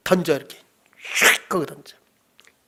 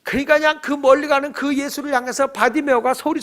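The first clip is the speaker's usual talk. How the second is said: ordinary talk, nothing marked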